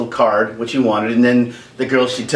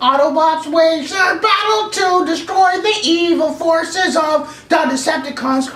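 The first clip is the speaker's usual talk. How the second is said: high, slow dramatic trailer voice; cartoon announcer; slower than usual. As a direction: announce it like a TV intro. Imitating the show announcer.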